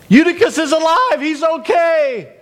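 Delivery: high, excited announcement; joyful calling out. Acted. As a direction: call the good news out, stretching the end. Acted joyful news spreading through home.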